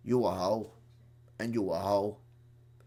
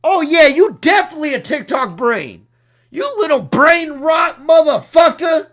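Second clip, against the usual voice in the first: furious shout; 9 semitones higher, 10 dB louder than usual. Real, not acted. Insulting a viewer in rant.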